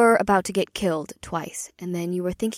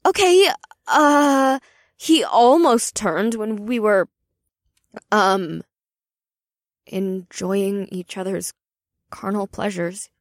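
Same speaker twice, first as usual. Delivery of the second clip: flustered; 7 dB louder than usual. Acted. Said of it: "Janine sputtered".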